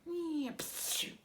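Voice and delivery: a little cartoon cat sneeze; silly kitty sneeze; puppet sneeze. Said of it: "what you're supposed to do when you sneeze".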